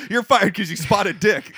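mock firing. Riffing on a cook firing someone, mock outrage. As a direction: pretend to sack someone, amused and incredulous.

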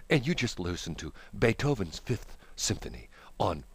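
classical radio host. Acted. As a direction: speak softly and unhurriedly, like classical radio. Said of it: "then you turn to a classical station".

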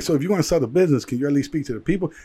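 nervous worker pleading with boss over dinner, rushed; rushed panicky. Retold or imitated. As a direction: rattle it off in a panic. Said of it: "So I'm like".

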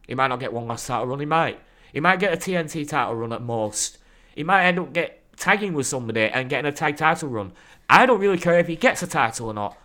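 ordinary delivery

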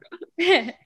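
high, loud burst of laughter; a bright, delighted laugh; big laugh. Real, not acted. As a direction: crack up at your own punchline. Laughs at her own story.

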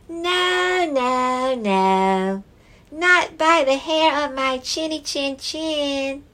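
high squeaky piglet refusal; small pig saying no; little pig voice. Acted.

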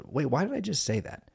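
gently questioning yourself; questioning inner voice. Retold or imitated. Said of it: "that other voice that goes".

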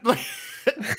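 high burst of laughter. Real, not acted. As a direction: crack up at the memory. Laughing at the memory.

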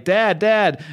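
put-on high, urgent child calling for dad; frightened kid calling. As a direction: call your dad like a scared little boy.

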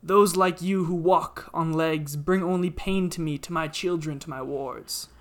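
booming, angry ancient tree; loud, wrathful forest spirit; talking tree. Acted. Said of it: "the tree speaks".

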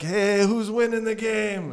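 a loose attempt at a Kenyan accent, called out high. Imitating Kenyan neighbour calling to card players, mock Kenyan accent. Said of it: "I don't know how to do a Kenyan accent".

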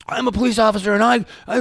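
high, loud mock rant of an enraged policeman; screaming. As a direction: imitate a man screaming his credentials. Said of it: "he's screaming at me".

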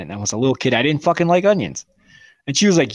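ordinary talk, nothing marked

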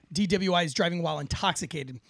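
ordinary talk, nothing marked

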